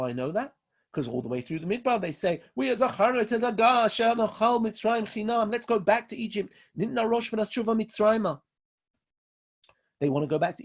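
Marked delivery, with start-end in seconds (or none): 2.5-8.4 lightly voiced clamouring people, pitched high; retold Israelites' complaint. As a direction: raise the pitch to imitate their plea. Voicing the complaining Israelites in desert.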